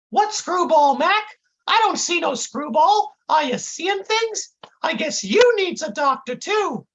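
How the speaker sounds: very high, baffled dimwit voice; confused kid. A narrator's reading. Intern looking round for the screwball, high confused voice. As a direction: pitch it way up, clueless. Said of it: "the kid looks confused again".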